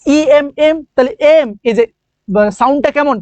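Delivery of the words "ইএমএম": "ইএমএম" said as an "e-mm" with a held m; sounding out M. Shown as a demonstration. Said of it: "এটাকে যদি তোমরা উচ্চারণ করো".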